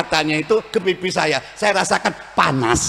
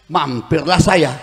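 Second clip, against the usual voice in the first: normal talking voice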